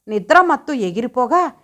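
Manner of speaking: ordinary delivery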